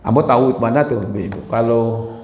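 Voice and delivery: usual speaking voice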